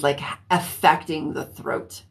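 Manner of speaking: normal talking voice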